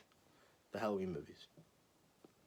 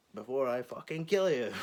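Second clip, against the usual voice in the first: louder, higher jokey threat; mock threat yell; 4 semitones higher, 8 dB louder than usual. Real, not acted. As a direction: bark the threat half-joking. Joking threat at chatter.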